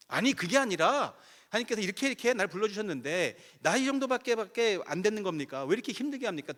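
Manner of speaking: high, loud protest to God; retold complaint. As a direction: burst out with the grievance again, re-enacted. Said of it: "또 불평이 시작돼요". (높고 큰 소리로 다시 터져 나오는 불평)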